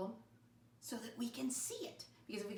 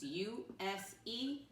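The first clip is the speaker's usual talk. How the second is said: spelling out letters; much slower than usual. Shown as a demonstration.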